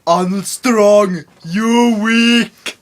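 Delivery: put-on slow, loud Austrian accent; Arnold Schwarzenegger voice. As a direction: drawl it like Schwarzenegger. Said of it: "Like Arnold Schwarzenegger".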